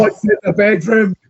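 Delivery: mock-alarmed question, high and loud. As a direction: play it as a spooked character. Acted quote in retold scene.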